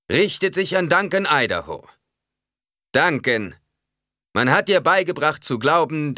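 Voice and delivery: loud, high, ringing oratory; preacher proclaiming. A narrator's reading. Preacher addresses Duncan Idaho before all, loud exhortation. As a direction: preach it out across a square.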